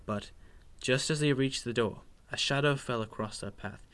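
plain reading voice

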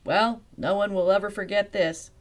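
loud fuming principal. Acted. Principal fuming at Velma, loud and angry. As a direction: bite out the words in anger. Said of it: "the principal fumed".

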